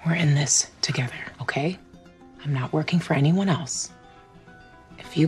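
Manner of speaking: urgent hushed whisper. Acted. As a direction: whisper it close and low. Urgent secret reassurance.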